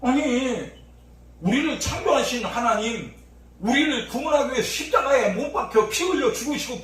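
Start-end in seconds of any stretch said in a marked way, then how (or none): none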